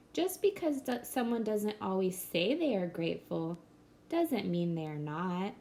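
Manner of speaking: plain reading voice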